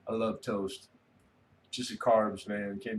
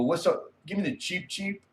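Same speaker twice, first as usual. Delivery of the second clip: cheeky customer; 3 semitones higher than usual. Retold or imitated. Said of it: "I go".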